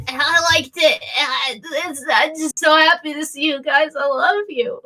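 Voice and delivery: put-on high, gushing drunken woman. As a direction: gush tearfully like an emotional drunk. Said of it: "over there stumbles the drunk woman".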